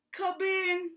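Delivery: hoarse sick bear rasp; raspy. A narrator's reading. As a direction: rasp it like a sore throat. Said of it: "he rasped".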